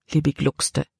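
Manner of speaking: ordinary delivery, nothing marked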